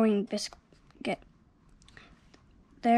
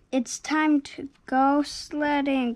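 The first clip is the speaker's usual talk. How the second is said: plain reading voice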